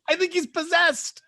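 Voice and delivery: mock spooked. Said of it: "I'm like".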